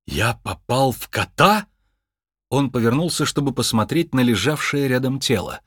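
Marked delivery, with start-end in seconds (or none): 0.1-1.7 slow, disbelieving question rising at the end; stunned question. Acted. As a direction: ask it slowly, in dawning disbelief. Finds himself inside a cat. (ошеломлённый вопрос)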